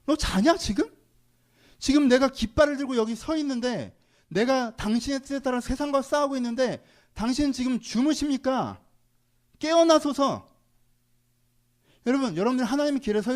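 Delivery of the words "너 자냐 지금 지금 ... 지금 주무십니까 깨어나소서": voicing David's cry. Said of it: "다윗이 하나님한테 뭐라고".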